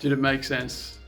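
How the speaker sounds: ordinary delivery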